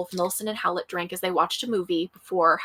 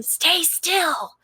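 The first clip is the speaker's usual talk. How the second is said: high, strained mock whisper; retold loud whisper, pitched well up; loud stage whisper; 9 semitones higher than usual. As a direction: hiss it out high and urgent, imitating the attacker. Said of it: "with Nelson loudly whispering".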